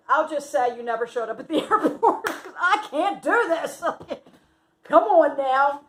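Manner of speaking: words breaking up with laughter; laughing protest. Real, not acted. Breaks into laughter retelling her protest.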